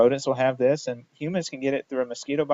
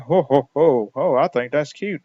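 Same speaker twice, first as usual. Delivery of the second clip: booming pretend ho ho ho, louder; a big jolly giant's laugh; hearty mock laugh; 6 dB louder than usual. Lecturer voices Paul Bunyan laughing at the rat, loud ho ho ho. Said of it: "So Paul Bunyan's laughing".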